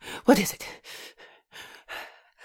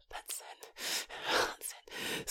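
very faint, breathy whisper; whispered encouragement; 19 dB quieter, much slower than usual. Acted. Nurse encouraging patient during a call, whispering.

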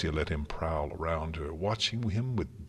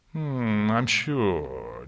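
lazy, sceptical hum; bored purr; 3 semitones higher, 4 dB louder, much slower than usual. A narrator's reading. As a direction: purr it as if unimpressed. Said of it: "Discord purred, looking bored".

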